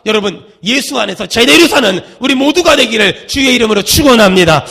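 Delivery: loud pulpit benediction, falling at the end; preached blessing. Real, not acted. Preacher blessing the congregation, loud declamatory close. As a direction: swell into a forceful blessing to draw an amen.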